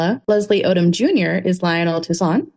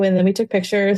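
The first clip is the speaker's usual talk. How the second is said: ordinary talk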